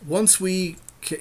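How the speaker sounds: ordinary talk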